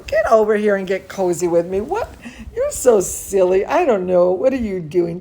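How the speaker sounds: ordinary delivery, nothing marked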